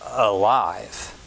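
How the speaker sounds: ordinary delivery, nothing marked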